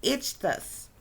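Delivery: Ichthys as "ITCH-thus"; careful pronunciation. Shown as a demonstration. Speaker retries the symbol's name for viewers, careful Ichthys as "itch-thus". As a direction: say Ichthys as two distinct syllables, "itch-thus". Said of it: "I believe it's pronounced".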